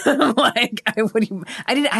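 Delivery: laughing high through the words. Real, not acted. Telling a friend about a cryptic text, laughing. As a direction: break into a laugh mid-sentence.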